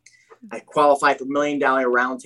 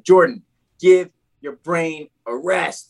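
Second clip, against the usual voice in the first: slow, higher mock-motherly plea; a lightly voiced mom, slow and a bit higher; imitating his mom; 4 semitones higher, 4 dB louder, slower than usual. Son retells mom urging rest, slow gentle mock plea.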